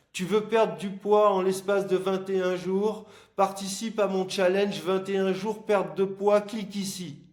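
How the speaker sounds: ad pitch voice. Retold or imitated. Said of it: "Je te jure. Voilà".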